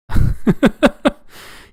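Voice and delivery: a sudden breathy burst of laughter; quick high airy chuckle; amused laugh. Real, not acted. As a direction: laugh at your own joke. Laughs at own remark.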